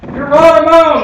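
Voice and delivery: long, high calling yell; yelling out the window. Retold or imitated. Mom yells from a second floor window for the dog. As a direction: call the dog home from the window. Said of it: "start yelling".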